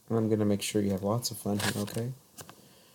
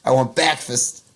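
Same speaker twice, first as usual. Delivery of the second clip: put-on higher, louder childish whine; mock cute demand; 4 semitones higher, 6 dB louder, slower than usual. Repeats the line in a put-on voice.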